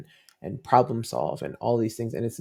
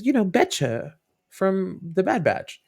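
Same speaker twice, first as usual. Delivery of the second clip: put-on higher child's voice explaining; little girl voice; 8 semitones higher, 4 dB louder than usual. Daughter explaining the dog's name, higher voice. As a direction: play the small daughter, matter-of-fact.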